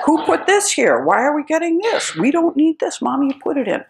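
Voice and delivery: put-on high, loud flustered old mother; upset mother. Mother upset at checkout about strange items, high and flustered. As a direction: fluster over the strange groceries.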